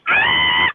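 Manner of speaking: sudden high yelled ah; random scream. Retold or imitated. Host showing her vocal stim, sudden loud scream. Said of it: "I start screaming out of nowhere".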